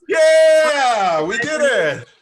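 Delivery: loud, high, happy cheer; excited cheer. Pretend. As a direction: cheer like a proud kid. Cheering like the class.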